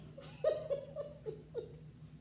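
airy high-pitched giggle; breathy high laugh. Real, not acted. Laughing at her heavy bunny, airy high giggle.

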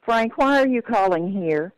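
usual speaking voice

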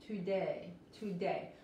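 TODAY with a firm D, close to a T; English D. Shown as a demonstration. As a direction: model TODAY with the tongue kept in for the D. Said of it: "La D en inglés suena más como la T".

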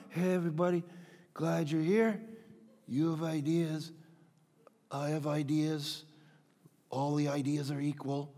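low, soft, lifeless drone with pauses; monotone. Acted. Preacher mocking a Jesus monotoning through life, monotone. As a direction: drone blandly, every phrase alike. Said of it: "not just monotoning his way through life".

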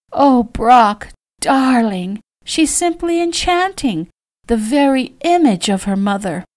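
high, doting mother voice; high-pitched enchanted cooing; delighted gushing. A narrator's reading. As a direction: gush admiringly to your son.

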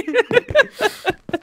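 high, helpless giggles; real laughing fit. Real, not acted. Player cracking up at the zombie joke, high giggling.

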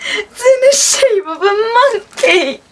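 high, tearful laughing squeal; delighted laughing. Real, not acted. Delighted by monkey gift.

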